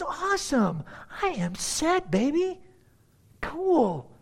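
high-pitched, lightly acted self-satisfaction; mildly gleeful Levite. Levite accepting Micah's offer to dwell with him, lightly gleeful.

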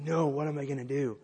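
a hammed-up cry of dismay; horrified friend. Friend who broke the vase, in mock horror. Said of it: "He was actually horrified".

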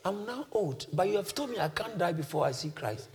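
put-on very soft, weary old man; a hint of weakness, hushed; lightly voiced frail elder. Old Simeon reminding God of his promise, softly frail. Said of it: "Weak, but full of the Holy Ghost".

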